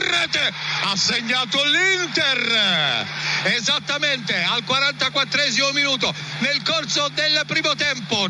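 high, slow, stretched goal shout; breathless italian goal commentary. Real, not acted. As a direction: erupt as the ball goes in. Goal scored, commentator erupts.

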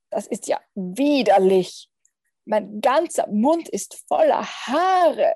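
loud revulsion with stretched key words; disgusted. A narrator's reading. Little vampire just bit a hairy friend, disgusted. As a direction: complain loudly and slowly, thoroughly grossed out.

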